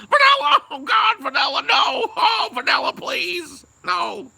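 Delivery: high, loud, hammed-up screams for mercy; mock beaten victim. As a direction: scream like someone being beaten, overdone. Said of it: "what he would have sounded like".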